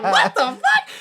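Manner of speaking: high, loud comic outburst; baffled yell. Retold or imitated. Viewer reacting to the music change, baffled high yell. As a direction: yell it in disbelief, laughing.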